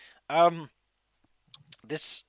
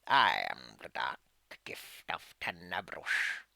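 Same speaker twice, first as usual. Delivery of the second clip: put-on old hag; 9 semitones lower, 5 dB quieter than usual. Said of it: "You hear a cackling voice".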